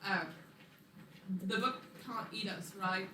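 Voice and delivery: ordinary talk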